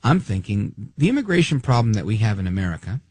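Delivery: ordinary delivery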